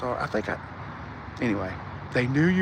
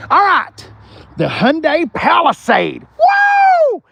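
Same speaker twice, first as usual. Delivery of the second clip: loud, high hype intro ending in a whoop; excited shout; 12 semitones higher, 6 dB louder, slower than usual. Real, not acted. Dealer hyping the Palisade for viewers, excited shout. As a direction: announce the car like a showman.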